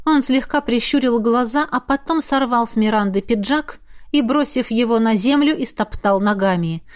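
usual speaking voice

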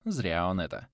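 plain reading voice

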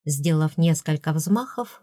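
ordinary delivery

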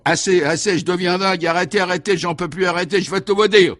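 high, loud, hysterical pleading; frantic begging. A narrator's reading. Gangster gives in to the commissioner, begging frantically. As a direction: crack completely, crying out for it to stop. Tormented suspect cracks and confesses.

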